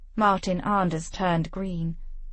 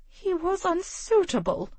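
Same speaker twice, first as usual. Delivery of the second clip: pinched lips; 11 semitones higher than usual. A narrator's reading. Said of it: "said through pinched lips".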